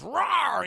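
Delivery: loud, high-rising imitated roar; mock beast roar. Sabretooth answers Toad's taunt with a mock roar. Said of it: "Sabretooth goes".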